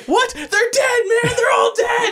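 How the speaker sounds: high, loud shocked yelling; alarmed shout. Retold or imitated. Finn hearing the gnomes are dead, high alarmed shout. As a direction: scream it back in horror. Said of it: "And he's like".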